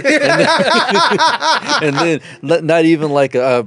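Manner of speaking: breathless laughing words. Real, not acted. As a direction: laugh through the line. Still laughing at story.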